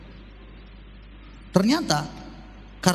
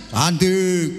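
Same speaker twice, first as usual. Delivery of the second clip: datar tanpa minat; acuh; 3 semitones lower, 3 dB louder than usual. Pretend. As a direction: jawaban suami malas. Suami menjawab acuh. (suami menjawab acuh tak acuh)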